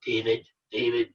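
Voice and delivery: a low, hollow moan imitating a demonic voice; eerie moaning voice. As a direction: lightly moan the name like a ghost on the phone line. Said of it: "it started moaning my name".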